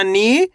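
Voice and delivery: "नी" drawn out, rising; long ee. Shown as a demonstration. Teacher builds the second half, long rising "नी". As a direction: stretch the ee in "नी" upward. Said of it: "खींच के पढ़ा जाता है".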